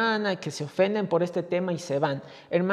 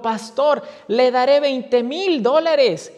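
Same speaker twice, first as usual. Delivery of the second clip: loud, slow yelled offer; shouted pledge; 4 semitones higher, 6 dB louder, slower than usual. Retold or imitated. Rich man shouts a bigger pledge to the pastor, slow and loud. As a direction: call it out slowly and loud. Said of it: "gritó".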